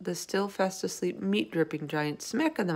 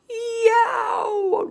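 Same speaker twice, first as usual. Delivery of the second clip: high, loud howl of pain; pained roar; 8 dB louder than usual. Acted. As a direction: roar out a long, high yelp. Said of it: "roared the flesh lump eater".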